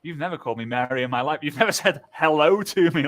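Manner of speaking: normal talking voice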